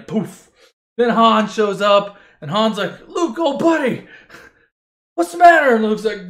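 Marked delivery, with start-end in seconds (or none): 3.1-5.7 1 concerned call. Acted. Han finds freezing Luke.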